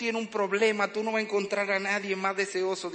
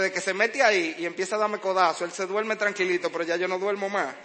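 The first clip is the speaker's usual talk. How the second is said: ordinary delivery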